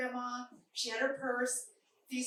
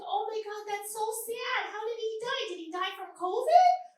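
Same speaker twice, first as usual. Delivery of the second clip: nosy lady; 9 semitones higher, 4 dB louder than usual. Retold or imitated. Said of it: "She's like".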